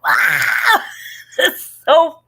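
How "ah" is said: loud imitated goat scream; a loud, laughing goat-toy scream. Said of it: "the screaming goat that's like".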